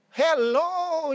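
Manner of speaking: high, loud acted hello; excited greeting. Mother greeting daughter at the door, excited. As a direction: call out a joyful hello, drawn out and high.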